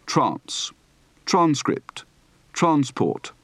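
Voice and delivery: "Trance" as TRAHNSS; long ah in "transcript" and "transport"; broad open-throated A. Shown as a demonstration. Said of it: "Practice for the broad, open-throated A".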